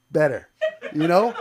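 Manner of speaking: normal talking voice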